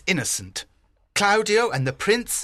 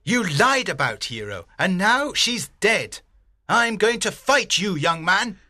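angry challenge. A narrator's reading. Enraged father challenges his accuser.

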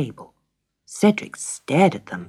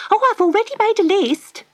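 high, eager wife's voice bubbling with news; a thrilled wife who can't wait to tell; excited wife; 4 dB louder, faster than usual. Acted. Wife Magda telling husband about the fairy letter, high and thrilled.

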